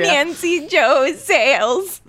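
high, slow, drawn-out whine; hammed-up wounded address; mock tearful quote. Co-host quotes Bling Ring complaint, mock tearful.

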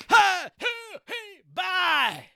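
high whooping yelps, then bye; high exit whoops. Acted. Departing guest signs off with high whoops. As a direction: toss out high little hoots. Bruce character makes exit noises.

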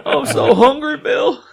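high, laughing whine; whiny hungry voice. Retold or imitated. Repeating the classic quote.

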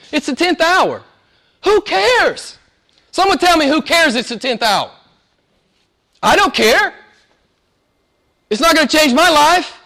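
high, loud mock-indignant preaching; comic preaching rant. Preacher mocking a dull verse detail to the church, hammed-up and loud. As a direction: ham up the outrage for laughs.

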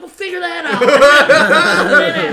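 slow, loud, laughing exasperation; exasperated laughing. Retold or imitated. Teller telling the crying guy to find out, slow laughing exasperation. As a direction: drag it out slowly through laughter. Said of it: "I'm like".

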